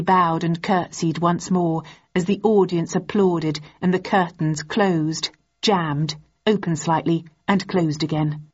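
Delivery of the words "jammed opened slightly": ordinary delivery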